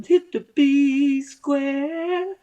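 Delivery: drawn-out squeaky falsetto call; high slow falsetto. Pretend. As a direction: stretch it out way up high.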